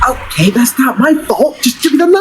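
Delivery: put-on high-pitched, insistent kid pleading; whiny sibling demand. Younger brother pestering for the butter knife, whiny. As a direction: whine for it, pitch climbing sharply.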